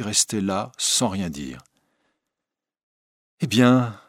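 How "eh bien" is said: higher, louder exclamation; exclaiming. A narrator's reading. Old lady exclaims to Raoul in greeting, lively. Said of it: "s'exclama madame Valerius".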